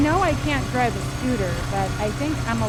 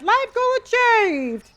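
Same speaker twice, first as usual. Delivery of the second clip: excited cheer; 12 semitones higher, 6 dB louder, slower than usual. Real, not acted. Excited game reaction.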